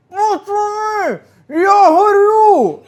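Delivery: drawn-out, high-pitched wail for help; retold panicked scream. Worker trapped in hot plaster calls his mates, mimicked scream. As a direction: cry out long, high and loud, mimicking agony. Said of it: "і він кричить".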